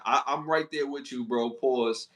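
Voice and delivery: ordinary talk, nothing marked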